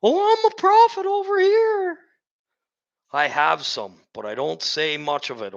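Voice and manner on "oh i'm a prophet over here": high, loud mocking squeal of a self-made prophet; mocking boastful prophet. Preacher mocks a boastful prophet in a high loud squeal. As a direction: sneer it up high like a show-off prophet. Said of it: "I'm not here for myself".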